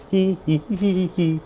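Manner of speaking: high loud cartoon snicker; Cartman laugh. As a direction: giggle high and nasty like a cartoon brat. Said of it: "Sorry, I act like Cartman".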